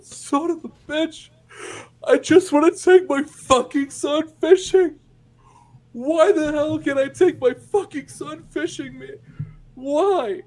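acted sobbing yell, very high-pitched; high, loud, tearful screaming; crying outburst. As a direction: wail in furious despair, imitating a crying father. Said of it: "starts crying".

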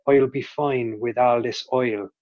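slow Southern Irish lilt on "fine" and "oil"; demonstrated Southern Irish key phrase; Southern Irish accent. Shown as a demonstration. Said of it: "with Southern Irish".

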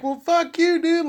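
high, sarcastic brush-off; mock retort. Answering a friend who won't listen, mock retort. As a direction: toss it off with mock offence. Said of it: "I'm like".

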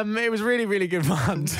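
ordinary talk

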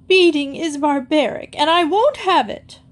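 high, tearful protest. A narrator's reading. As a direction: cry out in distress, pitch climbing. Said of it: "Missus Phillips cried even louder".

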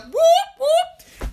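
high, loud cheering whoop; excited whoop. Real, not acted. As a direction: whoop it up like a fan. Cheering about halftime show.

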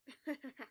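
short acted cackle; brief gleeful laugh in character. A narrator's reading. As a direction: cackle with glee. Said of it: "Tom cackles gleefully".

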